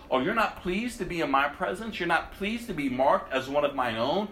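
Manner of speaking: ordinary talk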